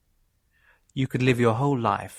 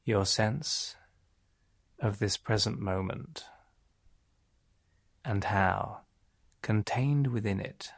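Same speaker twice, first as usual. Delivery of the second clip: guided meditation; 2 semitones lower than usual. Acted. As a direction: ease the listener gradually down, softening and slowing. Leads a relaxation meditation.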